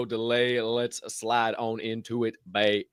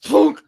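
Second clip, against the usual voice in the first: imitated thunk; 14 semitones higher, 10 dB louder than usual. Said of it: "and I went".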